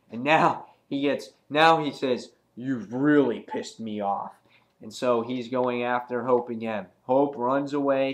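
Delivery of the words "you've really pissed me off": angered villain. Acted. Acts out Cyclops turning angry.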